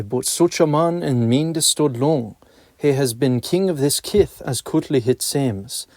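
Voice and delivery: ordinary delivery, nothing marked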